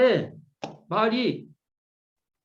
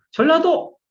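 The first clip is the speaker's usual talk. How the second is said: exclaiming the place; 8 semitones higher, 4 dB louder than usual. Acted. Calling out arrival in Jeolla.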